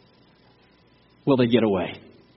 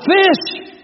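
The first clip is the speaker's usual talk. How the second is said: sudden high shouted call; yell; 12 semitones higher, 3 dB louder than usual. Retold or imitated. Fishermen in the boat spot a fish and call out, high yell. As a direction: shout it out like you just spotted a fish. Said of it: "and they'd yell out".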